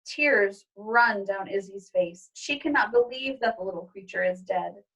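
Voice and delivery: plain reading voice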